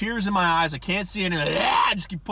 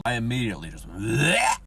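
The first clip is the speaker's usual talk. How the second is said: dry heaving; 5 semitones lower than usual. Real, not acted. Gagging on a patient.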